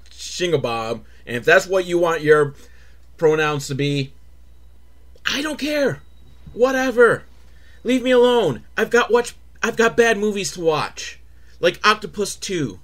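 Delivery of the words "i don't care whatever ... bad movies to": high, dismissive brush-off; mock dismissive. Brushing off anyone who cares about pronouns, high and dismissive. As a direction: wave them off, way up high.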